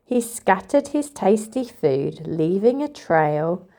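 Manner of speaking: plain reading voice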